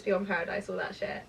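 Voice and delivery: ordinary talk, nothing marked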